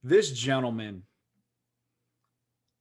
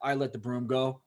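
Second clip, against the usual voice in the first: normal talking voice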